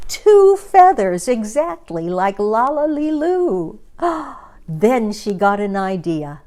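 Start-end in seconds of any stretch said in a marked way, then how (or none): 0.1-3.8 high, bright cheer of delight. A narrator's reading. Gertrude delighted at her new feathers, cheering high. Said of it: "and she cheered". 3.8-4.4 delighted gasp. A narrator's reading. Gertrude sees feathers.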